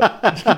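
short burst of laughter; laughing. Real, not acted. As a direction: break into a laugh. Laughing at own answer.

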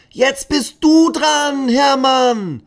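put-on high, loud children's chorus call; excited children calling the dino. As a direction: call out together like a class of kids. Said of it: "rufen die Kinder im Chor".

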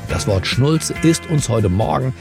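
ordinary talk, nothing marked

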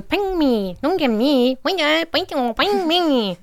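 hoch, langsam, singend wie ein Gerät; Reiskocher-Stimme. Retold or imitated. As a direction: Reiskocher nachahmen, langgezogen. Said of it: "der sagt auch irgendwie so". (hoher singender Reiskocher)

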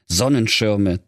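plain reading voice

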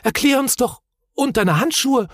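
high-pitched urgent call. A narrator's reading. Moomin calling after the wandering Hemul, high and urgent. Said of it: "rief Mumin".

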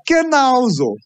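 sudden high squawk, a quick hint of Donald Duck. Coach shows the player how the voice will sound: Donald Duck squawk. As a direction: flip the pitch way up for a cartoon duck. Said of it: "„Donald Duck".

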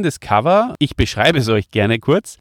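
usual speaking voice

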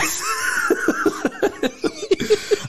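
a long, high, breathy laugh; real laugh of disbelief; high burst of laughter. Real, not acted. Cracking up with his cohost over the absurd scene, high laugh.

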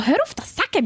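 flustered protest, imitating herself; embarrassed protest. Protesting friend's self-putdown.

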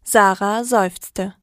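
plain reading voice

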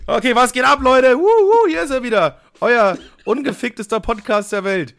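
laut, hoch und aufgedreht; Podcast-Intro. Acted. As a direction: wie ein Moderator, der die Menge anheizt. (aufgedrehte Begrüßung)

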